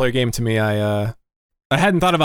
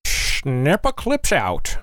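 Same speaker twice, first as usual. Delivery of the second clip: 1950s narrator; much slower than usual. Pretend. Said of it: "old-timey racetrack announcer guy".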